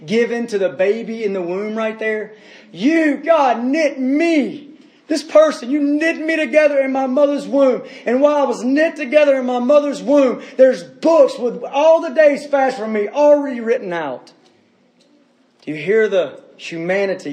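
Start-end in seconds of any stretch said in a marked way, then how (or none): 2.7-14.3 raised, impassioned paraphrase, higher and climbing to a shout; fervent preaching. Acted. Pastor paraphrasing the psalm to the congregation, fervent preaching. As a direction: preach the verse back with rising fervor.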